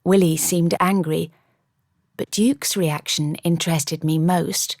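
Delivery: plain reading voice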